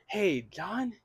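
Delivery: a quiet, puzzled "Hey, John?" rising at the end; confused greeting. Shown as a demonstration. Investor checking the owner's name, sounding confused. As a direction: sound unsure whether it is John.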